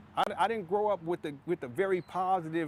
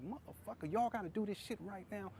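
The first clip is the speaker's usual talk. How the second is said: higher, softer mock yell at the neighbors; retold outburst, pitched up but held down; hushed retold complaint; 6 semitones higher, 8 dB quieter than usual. Retelling his outburst over fence.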